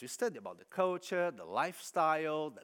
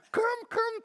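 high, loud calls for help; urgent calling; 8 dB louder than usual. Retold or imitated. Colleagues calling him to stop the fight, urgent loud calls. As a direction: summon them in a hurry.

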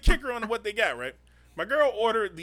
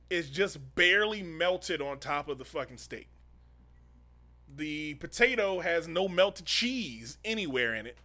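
slow complaint; 6 semitones lower, 4 dB quieter, slower than usual. Retold or imitated. Slow complaint about cold food.

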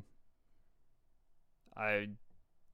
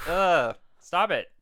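loud exasperated ugh; annoyed ugh; 7 semitones higher, 11 dB louder, slower than usual. Acted. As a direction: snap at him to stop. Brother spreading cinders.

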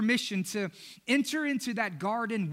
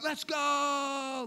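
higher pitched rally cry; hype shout; 5 semitones higher than usual. Retold or imitated. Disciples riling each other up, shouted hype. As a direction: shout it like a pumped-up fan. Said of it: "that's the disciples right there".

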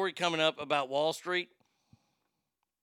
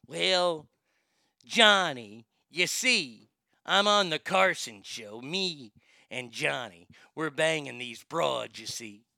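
slow, drawn-out celebrity drawl; Jack Nicholson; 3 semitones lower, slower than usual. Shown as a demonstration. Host trying a talk-show guest bit, slow drawling impression. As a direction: stretch words with lazy pauses. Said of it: "I don't really do that great, Jack".

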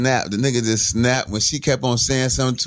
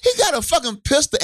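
worked-up disbelief; 8 semitones higher than usual. Retold or imitated. Retelling disbelief at the drunk.

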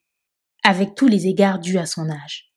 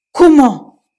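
Comment? shouted loud and higher in disbelief; shocked disbelief; 6 semitones higher, 10 dB louder than usual. Acted. Police chief who cannot believe his ears, loud shocked Comment?. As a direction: bark out Comment? stunned. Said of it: "n'en croyait pas ses oreilles".

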